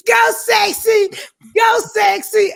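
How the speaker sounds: high loud cheer, drawn out. Retold or imitated. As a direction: cheer her on from the side. Cheering on [name].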